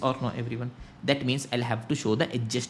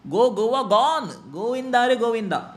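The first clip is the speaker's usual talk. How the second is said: playful singsong; 8 semitones higher, 4 dB louder than usual. Pretend. Playful singsong about lost goods.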